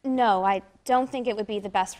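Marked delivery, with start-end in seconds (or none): none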